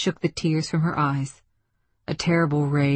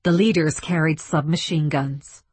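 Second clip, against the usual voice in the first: plain reading voice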